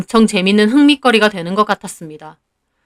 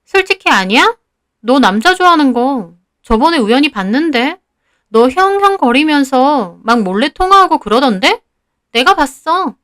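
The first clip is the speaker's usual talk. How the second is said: higher-pitched, needling schoolmate voice; lightly acted, higher accusing rival; accusing retort; 5 semitones higher than usual. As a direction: argue back pointedly, pitch swinging up. Rival student answers back accusingly.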